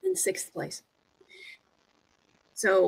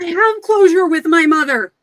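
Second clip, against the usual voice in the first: indignant pirate; 7 semitones higher, 9 dB louder than usual. Acted. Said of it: "Pirate is like".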